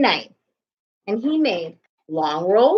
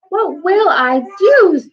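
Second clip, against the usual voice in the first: high, anxious baker's wail; a flustered baker frets aloud; worried cry; 10 semitones higher, 5 dB louder than usual. A narrator's reading. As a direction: panic over the spilled milk. Baker voiced in story.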